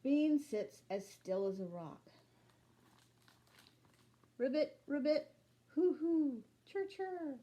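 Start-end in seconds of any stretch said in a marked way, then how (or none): 4.4-7.4 imitating frog, owl and cricket; animal chorus. Frog, owl and crickets making the night song, mimicked. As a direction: mimic the night creatures in turn.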